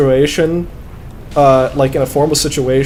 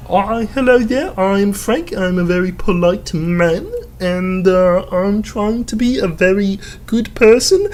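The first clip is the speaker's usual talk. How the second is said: put-on high, prim, sweet good-boy voice; a polite man introducing himself; polite Frank voice; 6 semitones higher than usual. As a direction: play the gentle mama's boy. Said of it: "Frank is".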